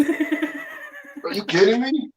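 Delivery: high, drawn-out disbelieving groan; disbelief. Real, not acted. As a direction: stretch it out in real disbelief, way up high.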